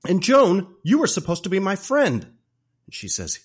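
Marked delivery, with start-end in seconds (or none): 0.1-2.2 high, loud, hammed-up accusation; betrayed outrage. Wife catching husband kissing her friend, outraged. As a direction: imitate a wronged wife crying out.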